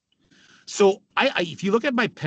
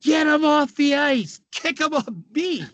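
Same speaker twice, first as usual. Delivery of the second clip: retold yelling; 10 semitones higher than usual. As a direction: yell it like an angry coach on the bench. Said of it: "And Sather is yelling".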